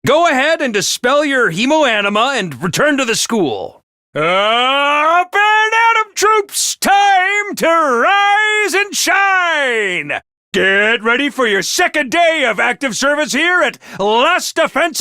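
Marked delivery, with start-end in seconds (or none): 4.1-10.2 high, slow, drawn-out morning call; cheery reveille holler; wake-up call. Acted. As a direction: stretch it out like a bugle call.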